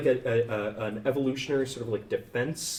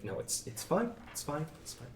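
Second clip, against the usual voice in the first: quiet, tight-voiced it's fine, much softer; strained reassurance; 4 semitones higher, 10 dB quieter, faster than usual. Barista waving off the students, quietly strained. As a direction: force the words out through held-back tears. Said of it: "he's like".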